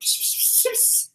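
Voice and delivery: breathy silent laugh. Real, not acted. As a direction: laugh without voice. Laughing at the spray.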